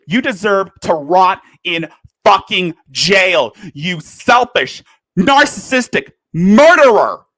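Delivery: loud, furious tirade rising into a cry; angry rant. Real, not acted. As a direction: let the anger build up to a crying yell.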